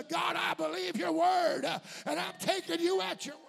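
full-force shouted declaration; preaching shout. Real, not acted. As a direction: cry it out to the church with fervor. Preacher crying out at climax.

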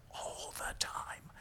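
conspiratorial whisper; sly joking whisper to the congregation; whispered aside. Acted. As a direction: let the truth slip under your breath. Teasing aside after denying arrogance.

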